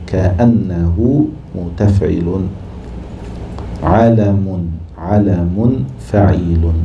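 verse scanned foot by foot, slow; slow scansion. Shown as a demonstration. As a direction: drawl out each foot as you name its pattern. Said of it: "teacher scanning rest of verse". (تقطيع عروضي بطيء)

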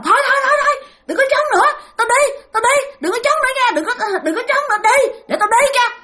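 high, desperate begging; pleading ghost. Retold or imitated. Beaten ghost begs the father to stop, high frantic pleading. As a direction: beg him to stop, frantic. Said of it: "van xin".

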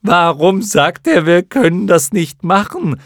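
high, loud creature voice; a silly little creature loudly demanding why; questioning creature. A narrator's reading. Third clown moth asks the others, questioning loudly. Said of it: "fragte ein drittes".

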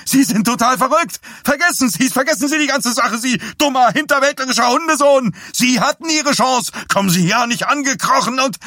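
hoch und laut keifend; schrill kreischend. A narrator's reading. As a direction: keift außer sich wie ein Fischweib. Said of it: "Seine Stimme klang schrill wie die eines Fischweibs". (Dees schreit Johnny hysterisch an, hoch und laut)

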